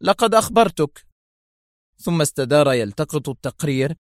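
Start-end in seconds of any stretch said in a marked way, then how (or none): 0.0-1.1 high-pitched, unbothered retort; mild cool rebuttal; coolness. A narrator's reading. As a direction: answer coolly, pitched up. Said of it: "أجابه في برود".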